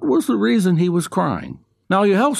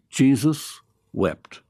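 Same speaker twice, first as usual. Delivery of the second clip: slow, weighty verse reading; solemn scripture reading; much slower than usual. Acted. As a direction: let the two words sink in slowly.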